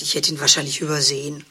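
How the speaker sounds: normal talking voice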